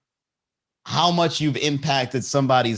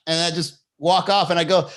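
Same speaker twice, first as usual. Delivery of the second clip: normal talking voice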